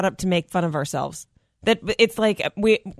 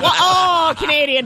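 high, loud cry of recognition; exaggerated startled spotting; mock realisation; 9 semitones higher, 6 dB louder, slower than usual. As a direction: call it out as if catching someone. Mock reaction spotting accent.

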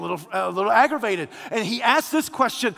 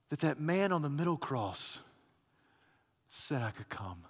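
soft preaching; 7 semitones lower, 9 dB quieter than usual. Acted. Soft sermon close after climax.

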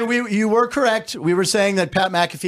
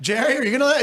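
normal talking voice

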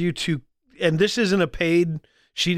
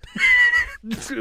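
high burst of laughter. Real, not acted. Laughing at the accent joke.

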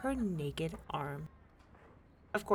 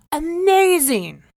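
much higher, loud, slow amazement; astonished gasp; 10 semitones higher, 15 dB louder, slower than usual. A narrator's reading. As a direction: gasp then marvel. King astonished.

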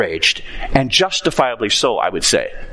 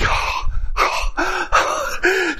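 gasp of disbelief. Acted. Freed servant gasping.

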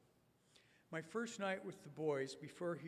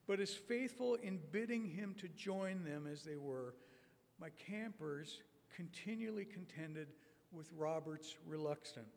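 ordinary talk, nothing marked

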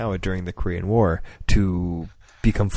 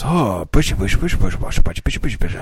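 mock Japanese gibberish; 6 dB louder, much faster than usual. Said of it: "he goes".

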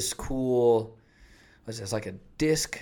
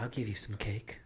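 whispering; 9 dB quieter than usual. Pretend. Sultry fridge voice teasing.

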